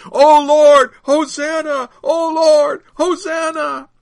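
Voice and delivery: full-voiced, high chanting shout. A narrator's reading. Disciple joining the pilgrims' chant, shouting. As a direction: join the crowd's chant at the top of your voice. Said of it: "I was shouting it too".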